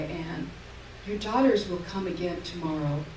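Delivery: ordinary delivery, nothing marked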